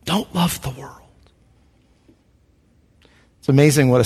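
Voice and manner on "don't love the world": the warning said again, near hushed; soft repeated warning, not a Welsh accent; echoed quote, even softer. Retold or imitated. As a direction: repeat it much softer after a long pause. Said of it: "he stopped in that Welsh accent".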